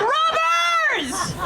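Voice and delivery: high shouted rubbers; echoing shout. Retold or imitated. Host repeating the comic's line, shouting high. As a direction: yell it so it echoes across the room. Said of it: "that thing echoed".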